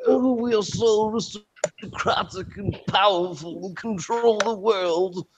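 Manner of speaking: high, slow mock aristocrat voice; mock aristocratic Brit. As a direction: lord it over everyone, drawn out high. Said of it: "they're like".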